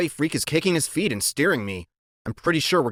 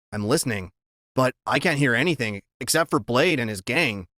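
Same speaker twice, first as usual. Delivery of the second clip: ordinary delivery, nothing marked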